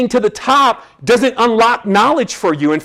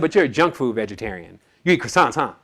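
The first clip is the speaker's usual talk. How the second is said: low teasing doctor; 7 semitones lower, 5 dB quieter than usual. Retold or imitated. Retold doctor teasing him.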